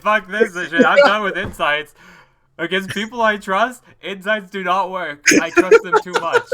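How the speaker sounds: loud exasperated rant ending in a laugh; frustrated outburst. Real, not acted. Player venting loudly about failed insight rolls.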